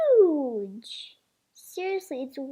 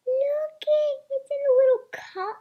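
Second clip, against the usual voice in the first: high, loud delighted squeal; excited squeal; 12 semitones higher, 6 dB louder than usual. Real, not acted. Unboxing a tiny toy for viewers, thrilled high squeal. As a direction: squeal at the cute little cup.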